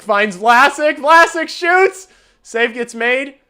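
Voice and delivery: loud, high-pitched live call of a shot; excited play-by-play call. Real, not acted. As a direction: shout the chance as it happens, pitch way up. Live call of a scoring chance.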